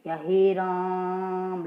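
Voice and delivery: very slow, with the vowel of "हे राम" held triple length; drawn-out "हे राम" showing a pluta vowel; long pluta vowel. Shown as a demonstration. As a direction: stretch "या हे राम" out long, like calling. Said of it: "तीन गुना समय लगता है".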